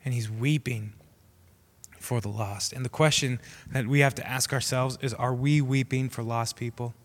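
ordinary talk

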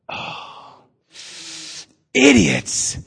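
breathy oh then a high scornful idiots; mock exasperation at the question; exasperated Jesus. Pastor joking how Jesus reacts to the crowd, mock scorn. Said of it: "And he's just like".

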